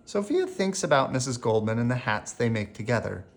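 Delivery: ordinary delivery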